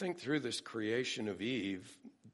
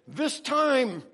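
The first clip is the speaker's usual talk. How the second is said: very high, loud exclamation; elated, ringing shout; joyous astonishment; 13 semitones higher, 8 dB louder than usual. Acted. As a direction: cry it out in joyful amazement. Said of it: "expressive of joyous astonishment".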